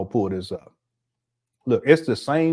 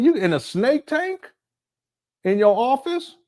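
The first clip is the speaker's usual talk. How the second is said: higher, mocking incredulity; disbelieving; 9 semitones higher than usual. Real, not acted. Mocking the host's office wish, incredulous. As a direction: pitch it up in disbelief. Mocking the snake tank idea.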